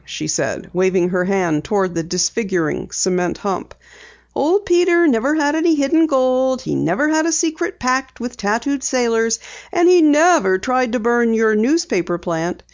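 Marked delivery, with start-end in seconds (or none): 4.3-12.6 a hint of a bright young girl, higher; light girl voice for Penny; Penny, lightly voiced. A narrator's reading. Penny telling her dad she'll drive, lightly voiced girl.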